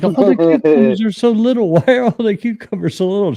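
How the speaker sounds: high, far-off innocent voice with a laugh; imitating wife. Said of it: "And she was like".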